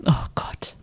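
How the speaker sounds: whisper. Real, not acted. Dreaded Trump-or-Putin question.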